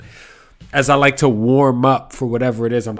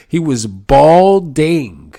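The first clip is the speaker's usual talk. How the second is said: usual speaking voice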